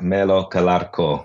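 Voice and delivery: guest's own name said the Italian way, in an Italian accent. Shown as a demonstration. Guest shows the host how his name sounds in an Italian accent. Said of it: "with an Italian accent".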